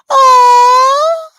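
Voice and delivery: squealed aww at something cute; a high, loud coo of delight; melting over a cute pet. Real, not acted. Reacting to a cute pet story.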